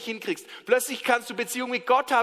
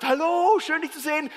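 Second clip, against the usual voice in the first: cheerful greeting; 7 semitones higher, 3 dB louder than usual. Acted. Neighbour greets farmer cheerfully.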